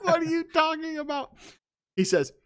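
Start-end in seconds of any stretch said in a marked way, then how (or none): 0.0-1.3 high-pitched hammed-up wail; mock crying. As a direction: wail in fake tearful desperation. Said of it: "she chases him crying".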